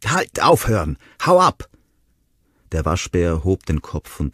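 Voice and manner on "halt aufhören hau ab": rising yell, high and loud at the end; shouting. Acted. Ronnie shooing the raccoon from the nest, shouting. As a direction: scream to chase the animal off. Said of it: "Schreiend und mit den Armen fuchtelnd".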